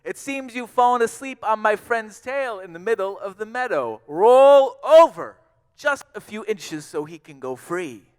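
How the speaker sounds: high, very loud urgent holler; full-force little mouse yell, key words stretched; shouting with all his voice. A narrator's reading. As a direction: shout right into a giant ear. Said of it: "he shouted with all of his voice".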